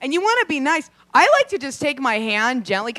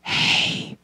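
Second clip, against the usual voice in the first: breathy creep hey. Retold or imitated. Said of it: "they're like".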